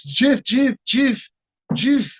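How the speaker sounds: high-pitched, excited calling out; French-accented impression, calling out; Jean-Claude. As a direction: call the name repeatedly, pitched well up. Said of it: "Do I have an impression of him?".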